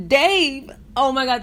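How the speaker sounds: usual speaking voice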